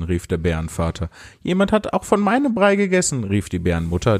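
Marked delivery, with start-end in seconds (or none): 1.4-3.2 put-on high-pitched childlike bear voice. A narrator's reading. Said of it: "dieselbe Stimme wie das Bärenkind".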